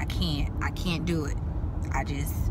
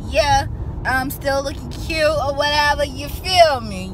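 sassy; 6 semitones higher, 7 dB louder than usual. Real, not acted. Sassy boast.

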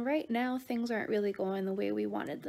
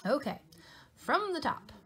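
plain reading voice

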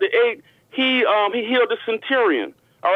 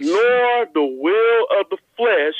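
slow, weighty, word by word; slow deliberate scripture reading; much slower than usual. Shown as a demonstration. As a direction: spell it out slowly for the listener. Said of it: "I'm gonna read it slow".

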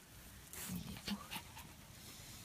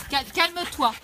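loud, higher order to calm down; 6 semitones higher, 13 dB louder, slower than usual. Real, not acted. Owner telling excited dog to calm down, loud. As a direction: settle the frantic dog.

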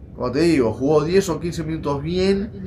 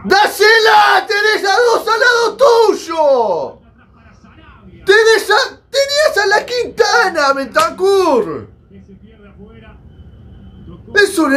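desperate shouting; 12 semitones higher than usual. Real, not acted. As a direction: beg him to pass. Screaming at player missing pass. (gritando desesperado a la pantalla)

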